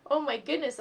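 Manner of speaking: ordinary delivery, nothing marked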